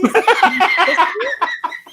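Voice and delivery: high loud cackle of real laughter; real loud laugh bursting out. Real, not acted. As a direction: crack up loudly. Host cracks up at story.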